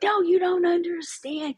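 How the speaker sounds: a lightly mocking take on a stressed husband. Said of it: "'cause he was so stressed".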